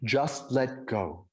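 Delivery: very slow, measured voice of God; God responding. Retold or imitated. God tells the hanging man, slow and serene. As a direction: spell it out slowly and calmly, as God. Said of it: "And God responds".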